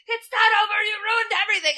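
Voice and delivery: high, loud angry shout; mad shout. Retold or imitated. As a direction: yell it in a fury. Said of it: "then gets mad and is like".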